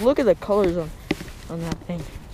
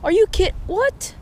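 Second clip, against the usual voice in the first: can't believe the rod tip snapped; shocked disbelief; 8 semitones higher than usual. Real, not acted. Angler to friend after his rod tip breaks, high shocked disbelief. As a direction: voice jumping high in real shock.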